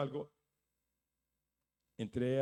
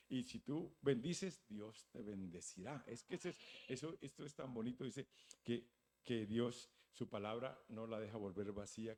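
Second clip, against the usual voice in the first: much softer, intimate sermon voice; hushed preaching; 2 semitones lower, 8 dB quieter than usual. Acted. Preacher to his church, softly sharing a tender truth. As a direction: draw them in close and gently.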